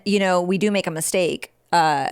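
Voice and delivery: ordinary talk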